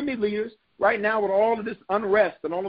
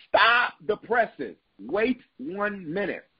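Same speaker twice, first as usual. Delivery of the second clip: "Stop the presses" drawn out slowly; slow right down to stop everyone; slow emphatic halt; 4 semitones higher, much slower than usual. Real, not acted. Preacher halting the congregation, slow and emphatic. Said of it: "preacher halts for emphasis".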